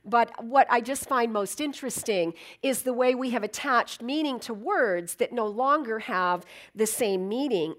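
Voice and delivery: ordinary talk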